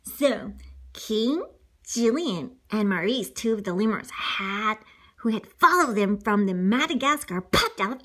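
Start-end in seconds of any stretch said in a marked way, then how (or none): none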